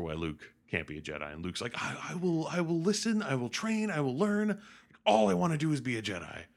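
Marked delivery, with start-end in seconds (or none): 1.8-6.4 high-pitched, mock-whiny pleading; earnest pleading. Luke begging Yoda for training, earnest pleading. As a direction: plead in short, insistent promises.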